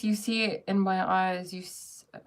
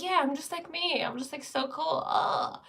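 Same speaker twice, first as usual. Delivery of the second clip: lightly voiced cocky wave, pitched higher; a touch of higher-pitched mock bragging; self-important wave; 8 semitones higher than usual. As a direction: play a wave that thinks it's its own thing. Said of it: "The wave thinks that's, that it's its own thing".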